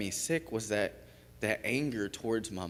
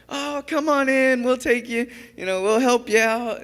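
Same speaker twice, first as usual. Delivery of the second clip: smiley, happy welcome; 14 semitones higher, 9 dB louder than usual. Retold or imitated. Shelter staff welcome an upset newcomer, smiley and happy. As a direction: imitate gushing greeters, pitched way up. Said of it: "they're so smiley and happy".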